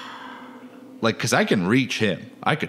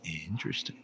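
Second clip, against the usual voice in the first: whispered; 6 semitones lower, 15 dB quieter than usual. Real, not acted. Whispered reaction to cutscene.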